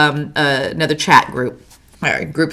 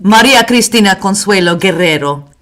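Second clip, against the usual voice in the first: put-on long name in a showy Spanish accent. Host adopting her long name as a joke, mock Spanish accent. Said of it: "start speaking with a Spanish accent".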